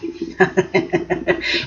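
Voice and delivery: a soft breathy laugh. Real, not acted. Laughing softly after voicing Quinn's scheming line. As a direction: laugh under your breath at the idea.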